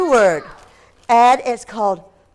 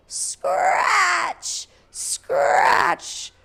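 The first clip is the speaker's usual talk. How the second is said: breathy scratching noise, drawn out; rasping scratch sound; 8 semitones higher than usual. A narrator's reading. Reading the monster noise to the class, a drawn-out scratch. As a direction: scrape the word out like claws under the bed. Sound effect in picture book.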